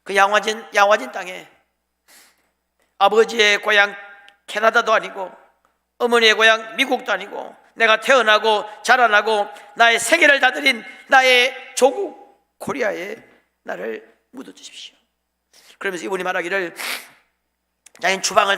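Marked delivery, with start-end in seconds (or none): 3.0-13.2 loud, building declaration; impassioned quoted plea. Acted. Acted plea of missionary to Korea. 13.6-15.0 sudden drop to soft; soft, solemn last request; hushed quoted plea. Acted. Plea drops at its end.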